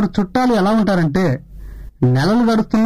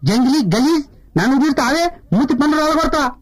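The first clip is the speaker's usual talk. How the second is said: mock angry threat; 6 semitones higher than usual. Insulted, threatens back.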